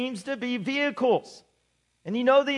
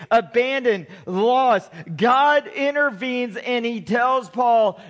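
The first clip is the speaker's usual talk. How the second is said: ordinary talk, nothing marked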